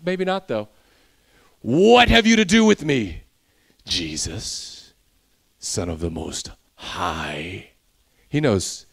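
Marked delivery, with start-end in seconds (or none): 1.6-3.2 a demon crying out to Jesus; loud cry. Acted. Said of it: "crying out with a loud voice". 3.8-7.7 low, hushed creepy demon voice. Acted. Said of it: "it would be like some sort of creepiness".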